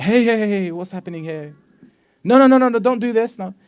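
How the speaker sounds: high, loud panicked "no no no" at a bad driver; yelling at the friend to stop; alarmed shouting. Nervous passenger shouting at friend driving badly, alarmed.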